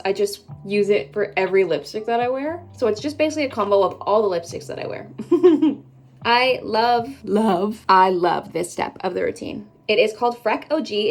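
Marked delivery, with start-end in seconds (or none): 5.1-5.8 brief bright laugh, louder; short laugh. Real, not acted. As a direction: laugh at herself. Laughs at lipstick combo.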